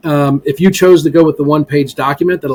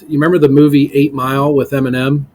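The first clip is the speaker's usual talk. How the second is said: normal talking voice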